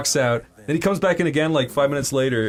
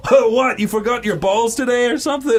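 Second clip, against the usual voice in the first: raised, high-pitched incredulous taunt; mocking doctor; 7 semitones higher, 4 dB louder than usual. Doctor teasing his yelping patient, mock incredulous. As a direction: imitate a doctor jeering, starting loud and high.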